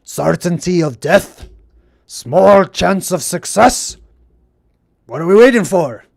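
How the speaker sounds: eager dwarf warrior relishing hopeless odds; Gimli quote. Retold or imitated. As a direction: growl it out like a battle-hungry dwarf. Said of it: "this is why I like Gimli".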